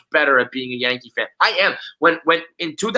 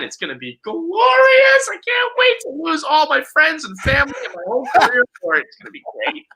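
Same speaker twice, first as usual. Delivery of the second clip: mock glee; 11 semitones higher than usual. Bragging about future Mets dominance, mock gleeful delight. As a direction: savor the coming doom theatrically.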